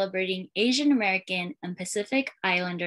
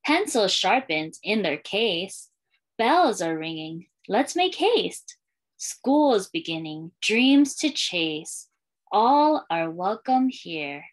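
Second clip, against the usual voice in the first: put-on lilting read-aloud for young kids, refrain given room; storytime reading; slower than usual. Librarian reading a welcome book to schoolchildren, storytime lilt. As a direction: read it like a picture book to a class.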